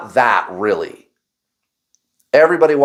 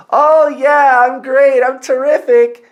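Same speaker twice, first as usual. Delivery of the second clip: much higher, louder forced cheer with a laugh; fake cheerful; 10 semitones higher, 4 dB louder than usual. Telling worried coworkers he's fine, hammed-up fake cheer. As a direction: put on a brave, chirpy front. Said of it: "And I would go".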